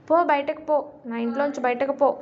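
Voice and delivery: ordinary delivery, nothing marked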